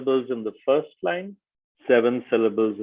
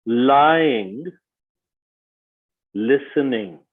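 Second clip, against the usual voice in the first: slow, spaced-out poem recital; measured verse, settling gently at line ends; haiku reading; much slower than usual. Shown as a demonstration. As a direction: let each phrase hang in a long pause. Reads example haiku aloud.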